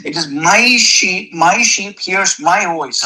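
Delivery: MY stretched out before "sheep"; emphatic, possessive MY; stress on MY. Acted. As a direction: punch and lengthen MY to claim them. Quoting Jesus claiming ownership.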